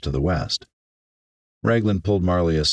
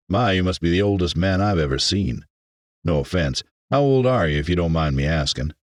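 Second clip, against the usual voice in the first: put-on folksy, frank young man; curious country shopman. Young shopman quizzes the old stranger, frankly curious. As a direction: ask nosily in a country twang.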